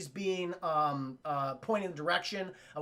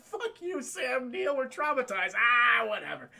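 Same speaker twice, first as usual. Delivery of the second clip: put-on kid voice; 3 semitones higher, slower than usual. Kids yelling at Sam Neill.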